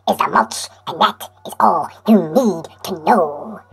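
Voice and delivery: breathy hushed whisper, mock solemn; dramatic whisper. Whispering the closing line to his viewers, hammed-up. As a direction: whisper it like a big secret. Dramatic close of music pitch.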